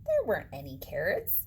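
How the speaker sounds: ordinary delivery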